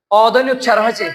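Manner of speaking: normal talking voice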